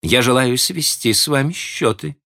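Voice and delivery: a hint of a teasing young woman; Miss Ivors. A narrator's reading. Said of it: "она неожиданно сказала ему".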